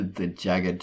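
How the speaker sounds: ordinary delivery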